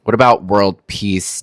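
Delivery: ordinary talk, nothing marked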